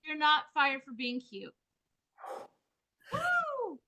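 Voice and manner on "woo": high, loud woo; excited cheer. Real, not acted. Cheering at the end.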